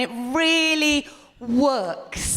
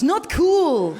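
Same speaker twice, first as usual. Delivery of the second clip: loud Dutch accent rebuke; 6 semitones higher, 5 dB louder than usual. Shown as a demonstration. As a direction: say it like a Dutch person. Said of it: "It sounds quite Dutch".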